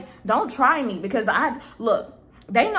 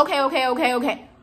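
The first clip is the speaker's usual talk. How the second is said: dismissive okays; 3 semitones higher than usual. Retold or imitated. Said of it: "he's talking about".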